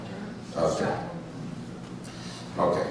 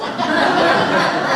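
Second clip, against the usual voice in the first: a quick, amused laugh; light laugh; 12 semitones higher than usual. Real, not acted. Speaker laughs after teasing the audience, light laugh. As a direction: chuckle at your own quip.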